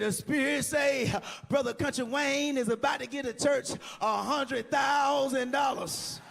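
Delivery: slow, high shouted sermon; preaching shout. Retold or imitated. Pastor preaching to the church, slow high shout. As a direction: call it out like a Spirit-struck preacher.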